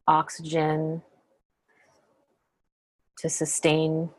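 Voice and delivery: calm meditation guide, long pauses between phrases; unhurried, settled voice leaving lots of silence; guided meditation. Acted. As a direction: guide them gently inward, phrase by phrase.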